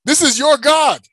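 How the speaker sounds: preaching to Pharaoh. Retold or imitated. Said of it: "he's telling this Pharaoh".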